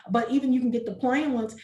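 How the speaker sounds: ordinary talk, nothing marked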